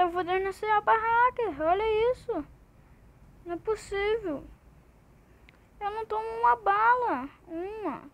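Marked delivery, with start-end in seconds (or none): none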